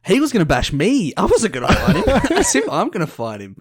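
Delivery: high laughing denial; laughing protest. Real, not acted.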